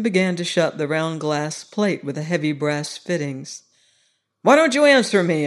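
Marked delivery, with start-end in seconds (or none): 4.4-5.5 sharp, higher and louder; impatient demand for an answer, higher and louder; rough demand. Acted. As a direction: bark the question at him. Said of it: "I said roughly".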